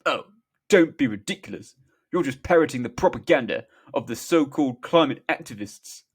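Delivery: loud, scoffing politician impression. A narrator's reading. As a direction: dismiss it with blustering scorn. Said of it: "Boris, scoffing".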